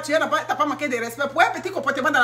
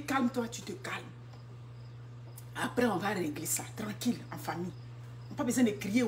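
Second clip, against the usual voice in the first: much softer calming; quiet calming; 3 semitones lower, 13 dB quieter than usual. Real, not acted. A mother advises calm settling in the family, in quiet calming. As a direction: hush it down to a calm.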